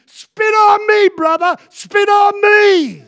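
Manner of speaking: high, loud, slow mock yell from the crowd. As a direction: shout it out like an excited elder. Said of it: "one of our elders yelled out".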